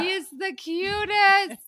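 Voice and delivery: high, slow, gushing coo. Real, not acted. Podcaster gushing about her favorite cast member, high cooing. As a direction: coo it like he's the sweetest thing.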